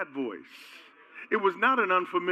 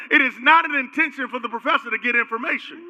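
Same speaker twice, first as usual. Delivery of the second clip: usual speaking voice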